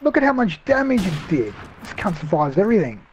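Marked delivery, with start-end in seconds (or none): 0.0-1.5 outraged. Real, not acted. Outraged at enemy survival.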